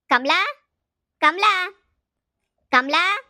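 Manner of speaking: loud, high call with rising end; name hollered upward, with pauses between. Acted. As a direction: call someone out of the house. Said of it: "ನೀನ್ ಕೂಗ್ತಾ ಇದ್ಯಲ್ಲ".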